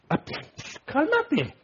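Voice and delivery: mock shushing. Preacher imitating people telling him to cool it, high and soft. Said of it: "la gente está".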